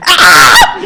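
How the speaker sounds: hammed-up scream of lost composure; mock scream. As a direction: let out a brief starstruck scream, played for comedy. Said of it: "I started screaming".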